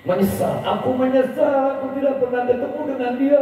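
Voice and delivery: high, wailing regret; high tearful lament of regret; weeping regret. Acted. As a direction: cry out your regret. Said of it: "Nangis ini Yahudi".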